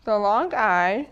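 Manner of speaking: slow and loud, leaning on long I; emphatic announcement. Shown as a demonstration. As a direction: announce the new topic to the class firmly. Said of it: "teacher announces the topic".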